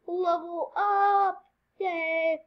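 slow, high, loud level up; triumphant announcement. Real, not acted. Gamer proclaiming the level up. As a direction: call it out like a fanfare.

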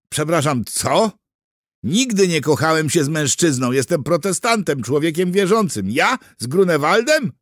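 high, loud indignant disbelief; stunned. A narrator's reading. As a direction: protest in shock at the accusation. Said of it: "Kuger oniemiał". (oburzone, głośne niedowierzanie)

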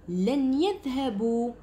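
slow, careful reading of لن يذهبوا; slow demo. Shown as a demonstration. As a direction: spell out لن يذهبوا slowly for the class. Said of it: "اعطيتكم لن".

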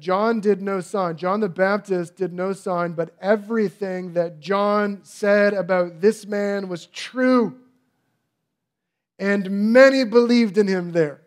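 loud, rising preacher's cry; preaching at full force. Acted. Preacher rousing the congregation, loud and rising. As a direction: build the quote to a full-voiced climax.

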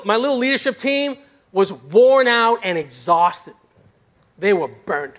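ordinary talk

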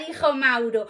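ordinary delivery, nothing marked

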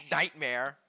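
normal talking voice

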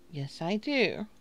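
usual speaking voice

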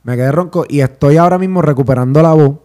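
ordinary talk, nothing marked

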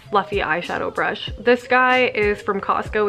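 ordinary delivery

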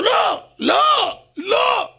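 very high, drawn-out crying shout; desperate plea. Acted. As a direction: hold back the verdict with all you have. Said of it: "ככה".

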